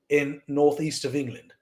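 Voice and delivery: normal talking voice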